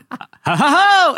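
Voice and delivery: forced "ha, ha, HA" leaping high; imitated signature laugh. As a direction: end a fake laugh on a high guffaw. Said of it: "Oh, you're that laugh".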